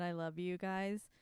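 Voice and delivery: ordinary delivery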